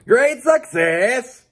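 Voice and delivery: loud, high, drawn-out mock Kazakh accent; Borat. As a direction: crow it slowly in a hammed-up Kazakh accent. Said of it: "My worst Borat impersonation ever".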